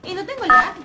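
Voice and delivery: loud triumphant brag; proud loud boast. Real, not acted. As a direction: shout it out proudly. Boasting zero ads. (presume a gritos)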